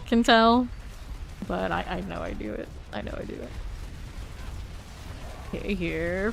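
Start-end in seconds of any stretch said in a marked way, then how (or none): 2.9-3.5 breathy whisper to self; whispered under the breath; whispered aside. Real, not acted. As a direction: drop to a whisper on the repeat. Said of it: "repeated as whisper".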